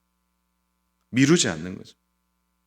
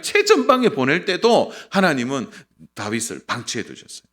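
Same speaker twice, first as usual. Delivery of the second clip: loud, raised preacher's voice; forceful preaching; 4 semitones higher, 7 dB louder than usual. Real, not acted. Preacher warning congregation that God may wait, forcefully. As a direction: drive each example home to the congregation.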